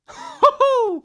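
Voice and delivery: a burst of high, loud laughter; short high laugh. Real, not acted. Laughing at his own revolutionary thought, a high burst of laughter. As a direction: laugh at your own joke.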